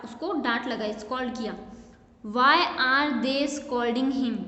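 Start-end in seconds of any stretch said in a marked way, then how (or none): 2.2-4.4 word by word, very slow and clear; slow classroom read-aloud; slow dictation. Shown as a demonstration. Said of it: "teacher reads sentence aloud".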